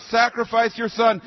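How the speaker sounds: ordinary talk, nothing marked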